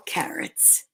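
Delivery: breathy whispered carrots. A narrator's reading. Telling kids the story, dropping to a whisper. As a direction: hush it to a whisper.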